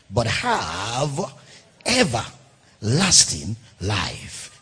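slow, low drawn-out words. Real, not acted. Preacher slowing the verse for the congregation. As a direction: stretch the phrase slowly to the end. Preacher drawing out scripture close.

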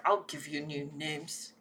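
low, very soft muttered threat; under his breath. Acted. Kitten mutters a threat at siblings under his breath. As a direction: mutter it so they barely hear. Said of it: "say under my breath".

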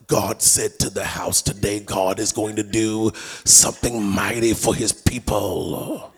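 low, slow, solemn pulpit parody; put-on prophetic preacher, every word drawn out; mock preacher voice. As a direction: intone it slowly like an affected preacher. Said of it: "You're talking like somebody else".

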